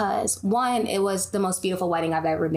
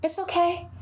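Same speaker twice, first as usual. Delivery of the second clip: gentle mock reassurance; 9 semitones higher than usual. Imitating serene calm friend.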